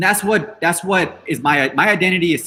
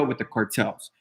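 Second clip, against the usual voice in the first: usual speaking voice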